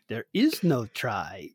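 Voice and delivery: slow, low Yoda imitation; Yoda accent. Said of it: "say it in the Yoda accent".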